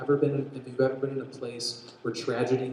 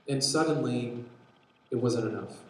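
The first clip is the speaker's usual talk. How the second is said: normal talking voice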